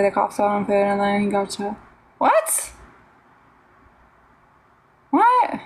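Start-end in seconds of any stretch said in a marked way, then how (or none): 2.2-5.6 high shocked squeak. Real, not acted. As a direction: react in disbelief. Shocked reaction to story.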